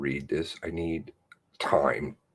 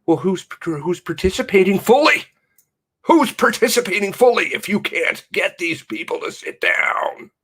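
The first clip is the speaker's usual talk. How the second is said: loud, high, furious shouting; angry shout; 14 semitones higher, 9 dB louder than usual. Real, not acted. Vlogger ranting at government news, shouting furiously. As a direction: yell it at the news in outrage.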